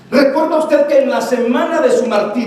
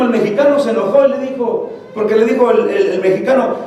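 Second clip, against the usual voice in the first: normal talking voice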